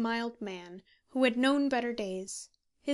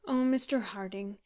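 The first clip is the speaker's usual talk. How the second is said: usual speaking voice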